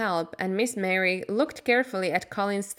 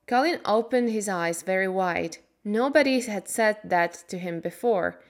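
plain reading voice

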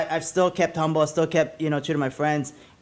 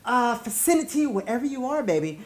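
hype man intro; 5 semitones higher than usual. Acted. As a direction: pump up the crowd like a club MC. Podcast host's hype intro.

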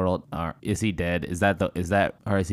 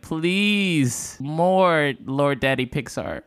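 pleading; 7 semitones higher, much slower than usual. Pretend. Begging voice for more trailer.

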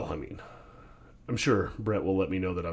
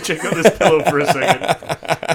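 joking through real laughter, louder; laughing; 7 semitones higher, 10 dB louder than usual. Real, not acted. Cohost laughing, teasing about hidden tears.

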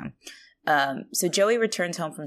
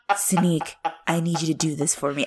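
ordinary talk

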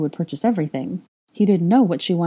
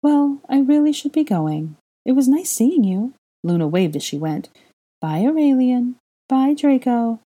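light airy voice; 8 semitones higher, 4 dB louder than usual. A narrator's reading. Luna voiced leaving.

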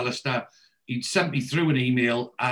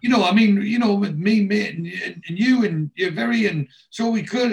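high, loud, stumbling over false starts; flustered babbling; 7 semitones higher, 4 dB louder than usual. Retold or imitated. Wayne fumbling to excuse himself to Brenda, babbling. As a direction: imitate a man babbling himself into trouble. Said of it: "the end of Wayne's babbling".

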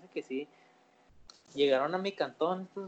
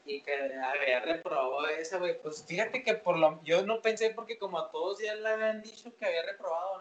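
ordinary delivery

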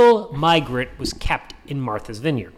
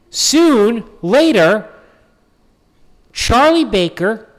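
loud build-up; 6 semitones higher, 5 dB louder, slower than usual. Real, not acted.

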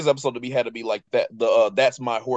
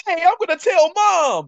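high whiny tattletale voice; whiny tattling little sibling; 5 dB louder than usual. Retold or imitated. Dexter voiced tattling in retelling.

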